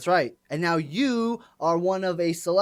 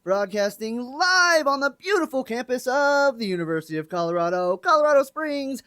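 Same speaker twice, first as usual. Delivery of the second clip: announcer intro; 6 semitones higher than usual. Acted. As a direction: introduce show and co-host like a ring announcer. Opens the radio show as announcer.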